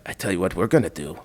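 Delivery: low, soft, deliberate scheming; conspiratorial French official. Retold or imitated. French official proposes a fake ID for the dog, conspiratorial. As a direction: imitate an official offering a secret fix, hushed. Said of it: "is French, though".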